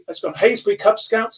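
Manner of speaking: normal talking voice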